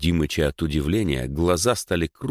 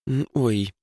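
mildly flustered little "oops"; higher, hesitant and a touch embarrassed; 6 semitones higher than usual. A narrator's reading. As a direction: falter as if caught out. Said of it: "Даша смутилась".

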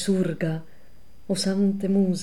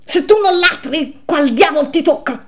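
high, loud, wailing shout; 11 semitones higher, 10 dB louder than usual. A narrator's reading. Another damned soul yells at Bocca, loud and high. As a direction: bellow it across the frozen lake. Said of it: "quando un altro gridò".

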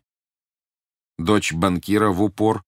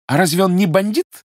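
high, loud mock astonishment; feigned surprise; 12 semitones higher, 7 dB louder than usual. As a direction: play innocent, wide-eyed surprise. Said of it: "изобразив крайнее удивление".

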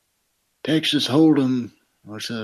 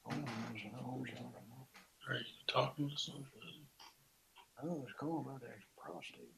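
muttering; 22 dB quieter, much faster than usual. Acted. Muttered aside.

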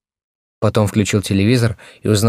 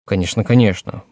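plain reading voice

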